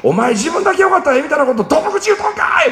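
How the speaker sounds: imitating mother, shouted high and furious; a mother tearing into her son over the phone; angry outburst. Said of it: "って怒り出したんですよね".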